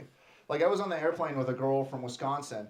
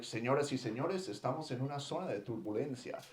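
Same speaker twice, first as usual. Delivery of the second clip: airline announcement; 7 dB quieter than usual. Acted. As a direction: recite a routine seatbelt notice into the intercom. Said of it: "They do the announcements".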